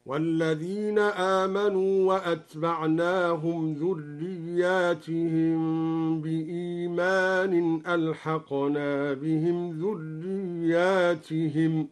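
slow measured recitation, "ذرياتهم" with long alif and kasra on the ta; measured Qur'anic demonstration, long vowels in "ذرياتهم"; recitation variant demo. Shown as a demonstration. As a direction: recite slowly, drawing out "ذرياتهم" as a plural ending -tihim. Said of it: "جمعا منصوبا بالكسرة، وكذا ألحقنا بهم ذريتهم هكذا".

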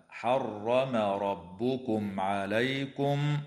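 عليكم chanted slowly with the meem before ع sounded clearly; slow chant, no nasal merging on the meem; tajweed demo of clear meem. Shown as a demonstration. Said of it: "وتظهر الميم الساكنة عند العين".

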